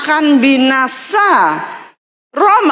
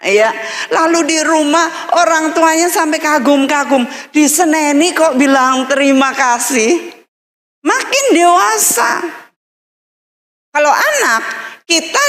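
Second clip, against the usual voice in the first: ordinary delivery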